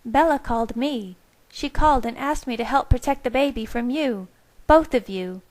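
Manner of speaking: defiant Rosalie standing up to them. A narrator's reading. Rosalie warning Carlisle and Edward, defiant.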